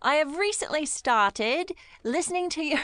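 high, stagey mock Cockney; Oliver-style Cockney accent. Host reads a UK listener's email, in a hammed-up Cockney accent. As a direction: play it like a musical urchin with a Cockney accent. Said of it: "like an Oliver accent".

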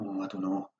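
hesitant inner voice. Pretend. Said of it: "weighing up".

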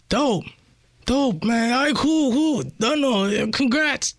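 high-pitched acted congratulations; match the caller's hyped-up pitch; re-enacted excited yelling; excited phone reply.

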